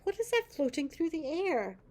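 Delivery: put-on puzzled little boy. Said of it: "He's like".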